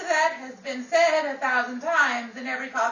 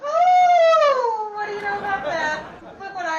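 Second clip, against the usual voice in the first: high, loud put-on gasp of surprise; mock surprise; 7 semitones higher, 8 dB louder than usual. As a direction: act astonished, hamming it up. [name] feigns surprise at purse.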